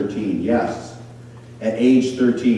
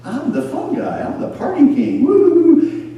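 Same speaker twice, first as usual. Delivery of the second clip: much higher, whooping party voice; mock party Satan; 5 dB louder than usual. Satan tempting the listener, hammed-up high party whoop. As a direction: ham up a party-king devil. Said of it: "Satan's like".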